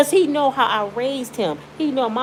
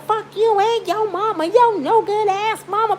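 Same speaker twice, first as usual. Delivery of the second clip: imitating her high, shrill-pitched yell; mock her screaming at him; retold hollering; 11 semitones higher than usual. Girlfriend cursing the son and his mama, mock high yell. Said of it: "She hooping and hollering".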